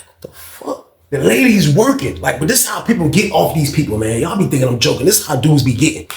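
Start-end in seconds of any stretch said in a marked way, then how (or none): 0.0-0.9 whisper. Retold or imitated. Stunned aside while watching.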